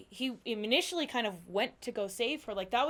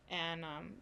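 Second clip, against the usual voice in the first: ordinary talk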